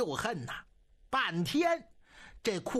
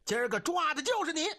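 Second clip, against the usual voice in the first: arresting challenge; 6 semitones higher, faster than usual. A narrator's reading. Soldiers seize him in bed.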